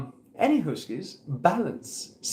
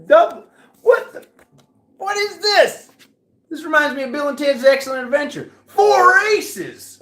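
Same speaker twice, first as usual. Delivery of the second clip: surprised exclaim; 12 semitones higher, 7 dB louder than usual. Real, not acted.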